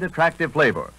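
ordinary delivery

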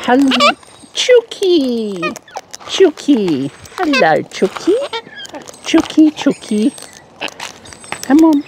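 high sing-song chook call; calling chickens. Real, not acted. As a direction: coax the hens in a high voice.

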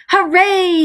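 high, loud joyful hooray; excited cry. A narrator's reading. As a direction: shout it out with delight. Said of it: "he cried".

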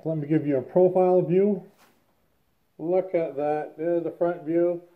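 muffled behind respirator; speaking inside half-mask; through a face mask. Real, not acted. Seen 1: Half-face respirator covers mouth and nose throughout the labelled words.

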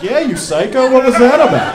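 high, loud laughing shout at dad; incredulous yell. Retold or imitated. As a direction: yell at him half laughing. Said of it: "I'm like".

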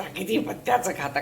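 the echo of "किती पथ्याचं खाता" said low and soft; quiet. Real, not acted.

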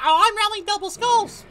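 high mocking whiny imitation; mock the opponent's complaint; mocking whine. Imitating the opponent's whine, high mocking voice. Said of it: "Yet he's complaining about".

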